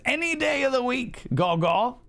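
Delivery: high, bright sing-song thanks; sing-song cheer. Acted. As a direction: chirp it up high like a happy greeting. Cheery sing-song reply to chat.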